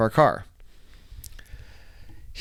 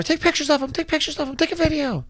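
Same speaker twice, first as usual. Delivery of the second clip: high-pitched mock yell; imitated yelling; 13 semitones higher than usual. Man mimicking angry lady ordering her daughter, high strained mock yell. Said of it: "she's yelling at her daughter".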